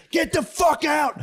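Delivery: slow, high shouted version of Get the fuck out; high shrieking falsetto. Retold or imitated. Comedian spazzing at the tongue-flicking fan. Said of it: "I spazzed".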